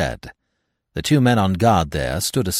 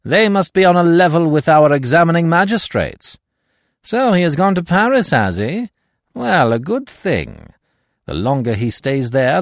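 higher, louder, scornful French detective; Giraud, disdainful; 10 semitones higher, 5 dB louder than usual. Acted. Giraud sneers at Hastings about Poirot's trip, loud and scornful. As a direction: toss off the English police with loud contempt.

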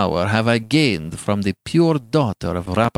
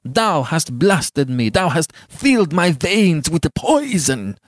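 raised, emphatic tirade; furious passion; 7 semitones higher, 5 dB louder than usual. A narrator's reading. Giovanni blaming Beatrice for poisoning him, beside himself. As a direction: accuse her in a mounting, impassioned rage. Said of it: "beside himself with passion".

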